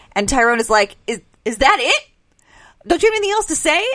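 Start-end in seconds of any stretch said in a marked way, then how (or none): 1.1-3.8 higher-pitched, incredulous question, mildly acted; retold disbelief. Host retells Tyrone confronting his parents, in mock incredulity. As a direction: voice a son's rising disbelief.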